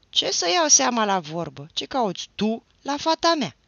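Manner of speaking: furious outburst from the gate; a father bellowing at his daughter's suitor; angry father shouting. A narrator's reading. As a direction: yell it high and loud at the boy.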